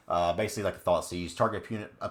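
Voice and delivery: ordinary talk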